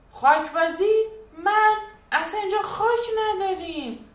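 high, loud outraged protest from a small bear; teddy shouting. Acted. Teddy bear yells he never played in dirt, loud protest. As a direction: shout the denial, indignant. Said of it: "خرسی پشمالو داد زد".

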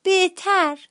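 put-on tiny high-pitched toddler voice; baby bear. A narrator's reading. Baby bear echoing father to sick sister, high toddler voice. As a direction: squeak it as a questioning baby. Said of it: "خرس عسلی هم گفت".

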